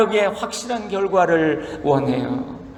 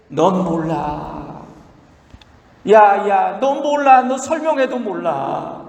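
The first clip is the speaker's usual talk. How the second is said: a hint of an exasperated father; lightly voiced God, chiding his child; God scolding; slower than usual. God answering Job's complaint, mildly exasperated parent voice. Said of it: "하나님 말씀하시기를".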